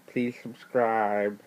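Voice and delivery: slow, drawn-out nerdy voice; nerd voice. Pretend. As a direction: stretch it like a nerd. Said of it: "that's my nerd voice".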